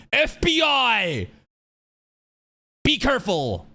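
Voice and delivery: drawn-out, high, loud mock alarm; mock warning. Retelling a scary website warning sign, hammed-up shout. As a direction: call it out like an exaggerated alert. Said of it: "some sort of sign warning says".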